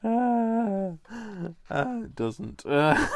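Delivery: high, loud laughing fit; real giggles breaking up the words; helpless laughter. Real, not acted. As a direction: try to talk through uncontrollable laughter. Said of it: "It's not even that funny".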